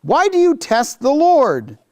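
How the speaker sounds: high, strained rebuke, slowing at the end; exasperated Moses. Acted. Moses rebuking the quarreling people, high and exasperated. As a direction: plead with them, worn out. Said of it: "And Moses said to them".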